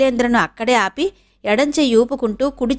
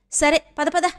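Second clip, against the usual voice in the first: mother eagerly agreeing to go along, higher and cheerful; cheerful eagerness; 6 semitones higher than usual. A narrator's reading. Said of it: "హుషారుగా నడవడం".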